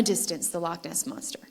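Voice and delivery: hushed secretive whisper. Retold or imitated. Telling the audience about grandma's sighting, whispered.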